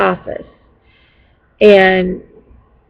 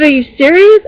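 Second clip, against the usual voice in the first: frustrated disbelief; 7 semitones higher, 6 dB louder than usual. Retold or imitated. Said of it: "I was frustrated".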